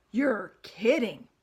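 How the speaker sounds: slow, loud astonished pig; amazed pig. Acted. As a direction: drag it out in disbelief. Wilbur amazed.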